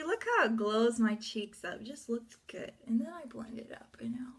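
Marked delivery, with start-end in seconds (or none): 2.9-4.3 whisper. Real, not acted. Whispered aside while blending.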